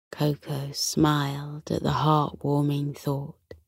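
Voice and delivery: ordinary delivery, nothing marked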